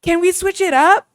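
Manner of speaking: put-on high, loud pleading girl voice; mock-whiny high plea; whiny girl quote. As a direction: whine it like a spoiled girlfriend. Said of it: "She said".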